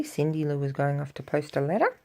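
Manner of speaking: ordinary delivery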